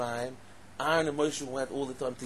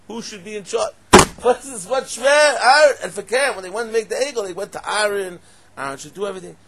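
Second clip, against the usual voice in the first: loud, high-pitched incredulous outburst; exasperated rabbi raising his voice; impassioned preaching; 8 semitones higher, 16 dB louder than usual. Acted. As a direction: argue the obvious at full force.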